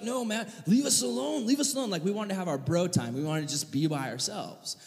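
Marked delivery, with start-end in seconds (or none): 0.0-1.9 mock kid brushoff. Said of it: "we're like".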